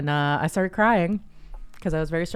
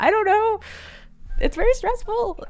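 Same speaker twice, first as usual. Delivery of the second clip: rising exasperation; 9 semitones higher, 5 dB louder than usual. Real, not acted. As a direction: voice climbs very high and loud. Retelling crying at clinic.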